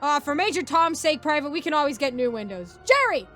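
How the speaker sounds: loud, high drill-sergeant yelling; military shouting. Acted. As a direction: bellow it like a sergeant giving orders. Sergeant and private bark in story.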